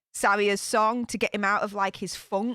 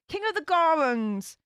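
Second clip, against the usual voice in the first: usual speaking voice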